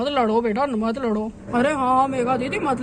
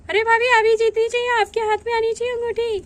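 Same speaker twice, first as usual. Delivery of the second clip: high, loud eager cheer; root hard for the bride; excited cheering; 8 semitones higher, 4 dB louder than usual. Acted. Relative cheers bride to grab the ring, excited.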